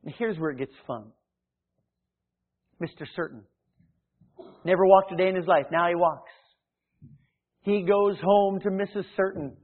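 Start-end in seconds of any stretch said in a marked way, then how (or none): none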